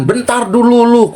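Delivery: calling after her as she runs off; retold call to stop. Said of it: "aku bilang gitu".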